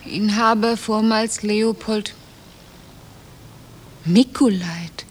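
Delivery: slow, fading to a whisper on the name; slow dawning read. Acted. Wife reads husband's true name on sign, slow dawning whisper. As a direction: realise as you read.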